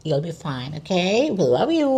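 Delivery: high, lilting pet talk; cooing reassurance. Real, not acted. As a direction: soothe the cat with rising, singsong endings. Reassuring her cat.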